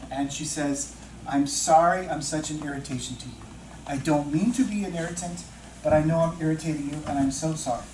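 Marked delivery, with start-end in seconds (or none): none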